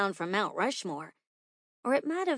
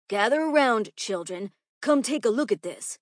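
loud, raised teacher's call; 3 semitones higher, 6 dB louder than usual. Acted. Teacher calls children to the box, calling out loudly. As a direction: summon the whole class, projecting.

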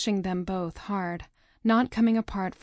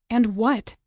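louder, sharp demand; impatient and louder and what; impatient interruption; 2 semitones higher, 6 dB louder than usual. Acted. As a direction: cut off his mumbling. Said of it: "I interrupt his useless mumble".

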